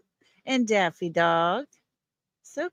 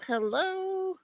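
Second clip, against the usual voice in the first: much higher, playful sing-song hello; playful squeaky hello; 11 semitones higher than usual. Pretend. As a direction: pop the second hello up high and cute. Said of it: "greeting chat viewers twice".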